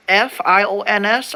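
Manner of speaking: "F I O N S" spelled letter by letter. Shown as a demonstration. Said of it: "Are you actually supposed to say it like that?".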